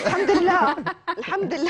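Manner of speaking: high, laughing relief. Real, not acted. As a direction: laugh it out, thankful. Guest laughs about her problem. (ضحك مع حمد الله)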